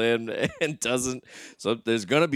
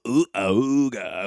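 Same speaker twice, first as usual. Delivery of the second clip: usual speaking voice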